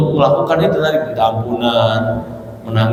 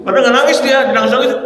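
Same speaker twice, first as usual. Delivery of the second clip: ordinary delivery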